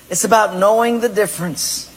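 ordinary delivery

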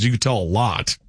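usual speaking voice

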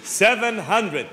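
"Seven hundred" said slowly, word by word; slow repeat. Acted. Tourist slowly repeating "Seven hundred" to room service. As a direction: spell out "Seven hundred" for the clerk.

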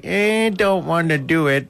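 a hint of a whiny refusal; reluctant daughter. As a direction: imitate a daughter balking at the idea. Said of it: "my daughter's like".

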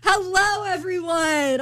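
high, chirpy greeting sliding down; lightly hammed-up American hello; American newcomer. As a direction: play up the obvious American greeting a room. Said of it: "I'm clearly the American person here".

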